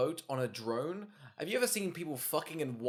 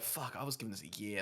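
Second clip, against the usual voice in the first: muttered aside; 7 semitones lower, 11 dB quieter than usual. Retold or imitated. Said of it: "He's like".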